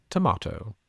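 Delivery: British "tomato", tuh-MAH-toh; British accent. Shown as a demonstration. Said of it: "I liked your accents there".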